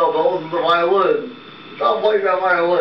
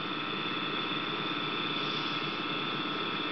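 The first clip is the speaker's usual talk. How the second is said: breathy spooky laugh; ghostly whispered cackle; 5 semitones lower, 17 dB quieter than usual. Acted. Ghost appears.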